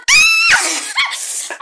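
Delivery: high shriek into laughter; laughing shriek. Real, not acted. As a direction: shriek with joy. Laughing shout.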